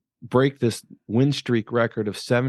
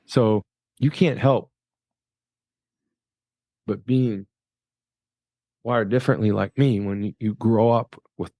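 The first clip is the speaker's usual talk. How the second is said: moved; 3 semitones lower than usual. Real, not acted. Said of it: "it's hard for me to get through that".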